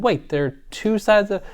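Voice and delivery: high, startled outburst; surprised exclaim. Retold or imitated. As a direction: blurt it out in sudden surprise. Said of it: "I said".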